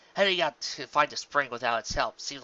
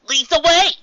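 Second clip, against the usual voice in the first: high, loud order; commanding shout; 16 dB louder than usual. Acted. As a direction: bark it at the rose. Des ordering the rose.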